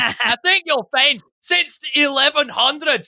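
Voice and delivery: high, strained Scottish nationalist rant; put-on Scottish accent. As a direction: declaim grievances in a hammed-up Scottish accent. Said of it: "to do that accent".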